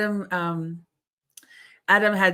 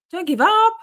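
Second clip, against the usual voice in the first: high, loud rallying cry, rising on UP; emphatic encouragement; 12 semitones higher, 7 dB louder than usual. Real, not acted. Streamer rallying a chat member who gives up, emphatic encouragement. Repeats encouragement, more forcefully.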